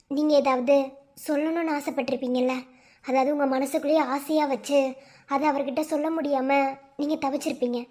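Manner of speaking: calm doctor. Acted. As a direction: gently instruct the family. Doctor advises family. (மென்மையான மருத்துவர் குரல்)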